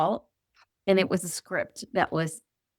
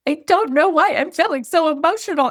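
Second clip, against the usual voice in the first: pitched way up, voice cracking with emotion; bewildered quote; 9 semitones higher, 5 dB louder than usual. Retold or imitated. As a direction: replay the teary confusion, much higher. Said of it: "I just said".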